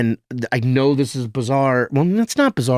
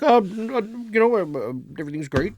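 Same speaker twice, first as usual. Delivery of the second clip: higher, put-on voice of the boss on the phone; lightly voiced boss dodging the news; impression of Lorne; 7 semitones higher, 4 dB quieter, faster than usual. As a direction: mimic his breezy evasive tone. Lorne voiced in retold call.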